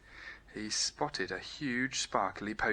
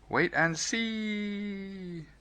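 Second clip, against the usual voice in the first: high, very slow teasing voice; cheeky sing-song tease; cheeky shout; 10 semitones higher, much slower than usual. A narrator's reading. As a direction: drag it out cheekily. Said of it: "he shouted with a cheeky grin".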